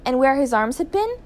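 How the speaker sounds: ordinary delivery, nothing marked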